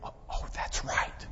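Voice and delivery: acted hushed whisper of sudden recall; whispered realization. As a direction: whisper it as it dawns on you. Acts David suddenly remembering.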